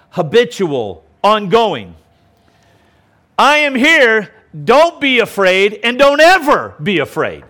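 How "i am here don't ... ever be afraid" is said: commanding shout. Acted. Preacher voicing Jesus to the frightened disciples, commanding shout. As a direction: proclaim it as a firm command.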